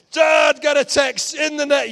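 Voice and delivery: loud, high shout calling out to Dad; son's excited shout. Retold or imitated. Son shouts the score at his dad, loud and high. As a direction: yell it like a kid who can't keep the score secret.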